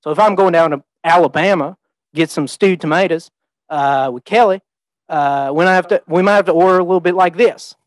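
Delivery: Alabama twang like a plucked banjo string; Southern twang. Shown as a demonstration. Speaker showing the audience how to order stewed tomatoes in Alabama, Southern twang. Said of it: "sounds almost like a banjo string".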